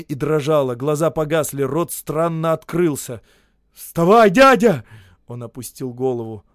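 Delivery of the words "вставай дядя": loud, higher urgent call. A narrator's reading. Narrator urging the fallen Osip to get up. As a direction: urge him up.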